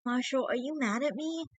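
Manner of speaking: put-on a hurt girlfriend afraid he's angry; upset Fiona. A narrator's reading. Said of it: "she sounded upset".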